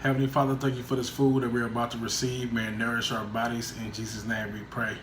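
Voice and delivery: quiet, lowering prayer voice. Acted. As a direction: pray softly and reverently. Saying grace before meal.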